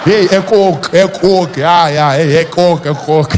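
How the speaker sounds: loud, repetitive faked agreement in Afrikaans; bluffing along. Comedian hiding poor Afrikaans from fan, bluffing agreement. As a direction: nod along in Afrikaans, pretending to follow. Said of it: "I can't speak so Afrikaans".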